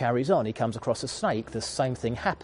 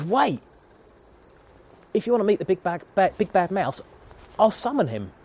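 higher, louder squeaky mouse pleading; a tiny mouse stalling for time, higher; the quick-thinking mouse, pitched up; urgent mouse; 7 semitones higher, 3 dB louder than usual. Acted. Mouse voiced in story.

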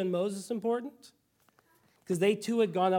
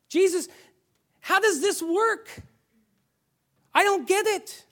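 much higher, pleading, baffled disciple; bewildered disciple; 10 semitones higher, 3 dB louder than usual. Acted. Disciples questioning Jesus about his death, high bewildered pleading. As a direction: ask Jesus like a confused follower whose hopes just collapsed.

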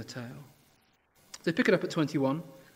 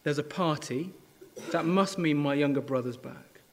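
ordinary talk

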